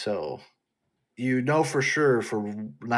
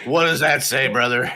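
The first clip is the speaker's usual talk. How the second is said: loud, higher, laughing hammed-up promo voice; mock wrestler shout; 4 semitones higher, 10 dB louder than usual. Joking to cohost about Rock's kids, laughing mock promo shout. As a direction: ham it up like a wrestling legend, cracking up.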